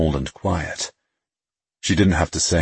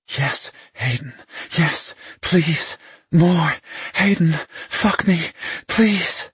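begging woman; 12 semitones higher, slower than usual. A narrator's reading. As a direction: climb higher with each plea. Woman begging mid-climax.

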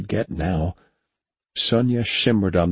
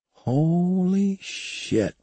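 stunned awe; 12 semitones higher, much slower than usual. A narrator's reading. Stunned at seeing Mars.

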